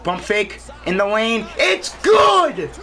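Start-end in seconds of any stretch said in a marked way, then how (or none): 1.6-2.7 high, loud triumphant yell; excited shout. Real, not acted. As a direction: scream with joy as it drops. Shot goes in.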